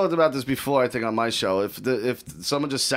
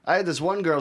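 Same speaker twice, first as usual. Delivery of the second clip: normal talking voice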